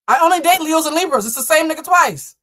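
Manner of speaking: high, loud rant; mock outburst. Reader ranting to viewers about Leos and Libras, mock outburst. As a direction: blurt it out loudly and high.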